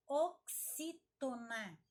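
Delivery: "oxítona" slowly broken up, strong on "xí"; slow, emphatic dictation of "oxítona"; syllable by syllable. Shown as a demonstration. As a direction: split "o-xí-to-na" for emphasis. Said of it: "sílaba é a mais forte".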